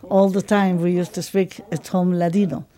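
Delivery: normal talking voice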